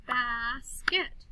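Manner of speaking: BASKET slowly in two beats, bas-ket; teacher leading "Bas-ket" for the class; split into syllables. Shown as a demonstration. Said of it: "Clap the syllables with me".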